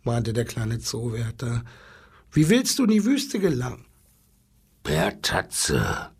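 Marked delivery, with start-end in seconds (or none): 2.3-3.9 high, quick keeper's voice; little zookeeper. Acted. Little zookeeper asks the lion, high and quick. 4.8-6.1 low lion growl. Acted. Lion answers the zookeeper, low growl. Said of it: "grollte der Löwe".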